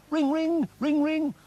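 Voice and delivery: high, loud sing-song ring ring; vocal phone ring, high and loud; mock phone ring. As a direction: imitate a ringing telephone with your voice. Mimicking phone ringing.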